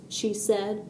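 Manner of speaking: usual speaking voice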